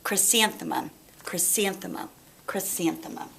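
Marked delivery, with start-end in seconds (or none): none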